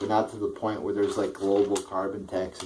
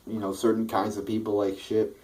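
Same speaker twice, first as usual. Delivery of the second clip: ordinary delivery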